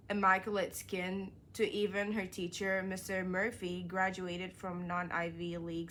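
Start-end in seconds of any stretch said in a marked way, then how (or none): none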